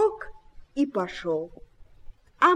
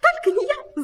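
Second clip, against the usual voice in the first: high squeaky little mouse cry; mouse's cry; 13 semitones higher than usual. A narrator's reading. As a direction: pipe up quickly, very high. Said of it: "закричал один".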